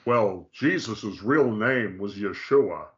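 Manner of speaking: slow, drawn-out mock quote; imitating Christians. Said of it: "Christians want to say".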